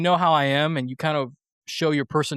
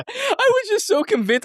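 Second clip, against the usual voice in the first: real laugh through the words, much higher. Real, not acted. Host laughing at his own awkward exit, real laughter. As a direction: crack up while admitting it.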